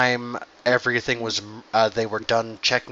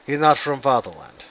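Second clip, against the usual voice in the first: put-on Russian dwarf accent; 2 semitones higher than usual. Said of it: "now dwarves are Russian".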